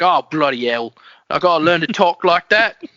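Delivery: loud, hammed-up Cockney grumble; amateur Cockney accent, played for laughs; mock Cockney. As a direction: complain in a rough attempt at Cockney, pitch climbing. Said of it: "I can't do Cockney very well".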